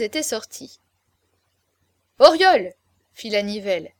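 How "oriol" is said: high, loud call; summoning call. A narrator's reading. La Nivelle summoning Oriol across the room, a loud high call. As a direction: call him sharply by name.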